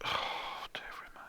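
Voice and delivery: soft whispered dearie me; mock pity whisper. Listener reacting to the sad lyric, whispered. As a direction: sigh it out in pity. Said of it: "you're like".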